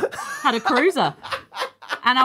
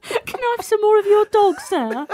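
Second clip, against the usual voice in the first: high, hammed-up Cockney orphan; Oliver Twist; 11 semitones higher, slower than usual. Co-host mocks the boy, pleading orphan voice. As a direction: beg in a mock British urchin voice. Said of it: "What is he, Oliver Twist?".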